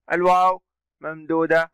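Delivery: normal talking voice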